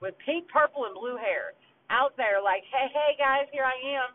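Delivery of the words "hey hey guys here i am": high, loud sing-song hey guys; mock calling out. To cops who never spotted her, a loud mocking hello. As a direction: wave at the cops in a mocking call.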